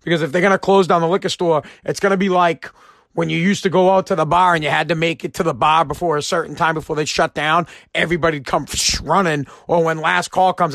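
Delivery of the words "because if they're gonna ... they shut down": normal talking voice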